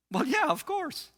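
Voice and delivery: very high-pitched indignant reply; imitated young woman. Fiancée answering the counselor's doubt, high mock voice. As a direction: squeak it out as her.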